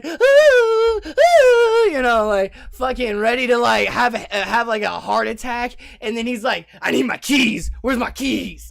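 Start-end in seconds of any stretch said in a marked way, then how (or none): 0.0-1.8 high, loud sobbing ah; mock sobbing wail. Cashier lady sobs at the robber, a hammed-up high wail. 6.8-8.7 robber demand. Retold or imitated.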